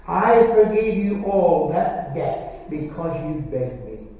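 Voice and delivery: slow, weighty master's rebuke; stern master. Acted. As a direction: judge him word by word. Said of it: "said to him".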